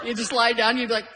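ordinary delivery

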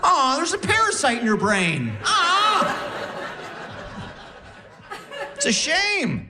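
high, cooing fake sympathy; mock pity. Husband mock-consoles wife about her brain, high and cooing. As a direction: coo it high, sarcastically sorry.

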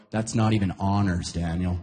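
low-pitched, lightly acted reproach; mock disapproval. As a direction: imitate an unimpressed friend correcting you. Friends look down on his remark.

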